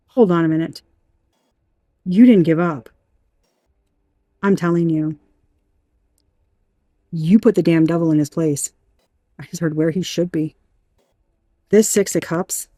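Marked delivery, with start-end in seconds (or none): none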